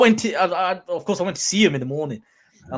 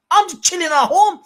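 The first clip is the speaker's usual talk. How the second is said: ordinary talk, nothing marked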